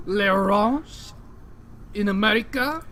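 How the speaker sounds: cartoon Frenchman fumbling the topic; mock French accent. Cartoon Frenchman describing the ranch to the podcast, high French accent. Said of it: "talking in a French accent".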